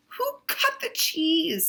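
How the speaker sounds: high squeaky rat; rat's voice. Acted. Rat teasing about the smell, high squeaky.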